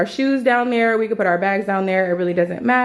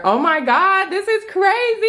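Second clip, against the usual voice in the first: amazed; 11 semitones higher than usual. Real, not acted. Amazed at full fridge.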